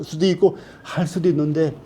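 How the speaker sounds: ordinary talk, nothing marked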